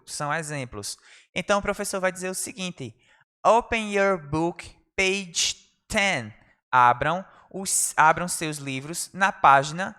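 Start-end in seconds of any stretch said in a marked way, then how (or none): 3.4-6.3 slow, firm classroom command; drawn out for learners to copy; slow model command. Shown as a demonstration. Teacher modelling class instruction, slowly. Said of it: "teacher models phrase slowly".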